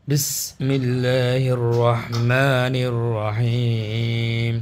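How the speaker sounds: slow, drawn-out chanted recitation; Quran recitation. Real, not acted. Teacher opening the Quran lesson for students, in slow recitation. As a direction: intone each word slowly, like reciting in prayer.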